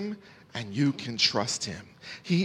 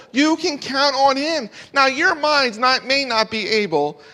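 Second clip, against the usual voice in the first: building to high, loud proclamation; impassioned pulpit voice climbing in pitch; fervent preaching; 8 semitones higher, 5 dB louder than usual. Real, not acted. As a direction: preach it with rising conviction, one short punch after another.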